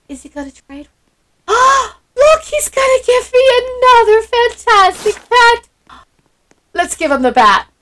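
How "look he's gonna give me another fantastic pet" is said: high, loud thrilled cry; excited shout. Real, not acted. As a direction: burst out with delight. Another trade offer, thrilled.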